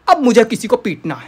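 low, loud, fed-up declaration; grim low-pitched threat; contained threat. Acted. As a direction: announce it low and forceful. Fed-up teacher's threat, first take.

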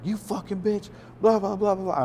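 high, raging outburst, cut off with blah blah; mock furious yell. Marshall jumping out of the car at Kim, mock furious yell. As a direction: lash out at her, then trail into blah.

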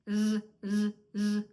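"з" as a firm voiced buzz; loud, drawn-out voiced "з". Shown as a demonstration. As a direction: buzz each "з" separately with full voice. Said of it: "і дзвінко з".

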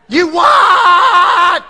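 high, loud mock bellow; outraged shout. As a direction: explode in disbelief as the father-in-law. Father-in-law wakes up outraged.

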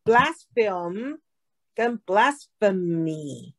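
"Blaspheme" rising on a held M, then slow "blasphemy" ending in mee; contrasting two words. Shown as a demonstration. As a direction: set "Blaspheme" against "blasphemy", stretching both endings. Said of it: "两个不一样".

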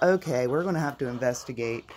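ordinary talk

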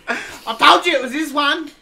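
high, loud laughing gloat; excited laughing shout. Real, not acted. Gloating after the unbox.

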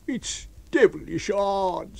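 high, slow, aggrieved whine; complaining tramp. A narrator's reading. Tramp complaining to his unseen master, aggrieved. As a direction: drag out a high-pitched complaint.

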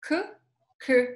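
K tried alone as a short "kø" syllable; practising Danish vowel. Shown as a demonstration. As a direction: repeat K as an isolated "kø". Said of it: "Try again".

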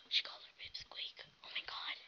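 gasp, then a breathy scandalised whisper; hushed gossip voice; shocked whisper. Pretend. Shocked onlookers whisper about insult.

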